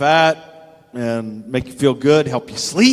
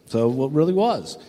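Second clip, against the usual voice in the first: normal talking voice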